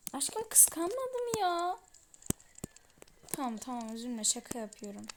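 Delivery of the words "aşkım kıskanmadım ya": much higher and slow, dragged-out protest; pouting denial, high and drawn out; whiny denial. Acted. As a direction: stretch the denial out like a sulky girlfriend. Girlfriend denies being jealous.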